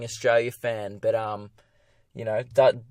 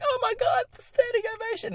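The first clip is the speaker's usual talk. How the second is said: much higher, squealing gush of amazement; mock gushing crowd; 4 dB quieter than usual. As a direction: squeal it like a starstruck audience. Crowd reacts to golden buzzer.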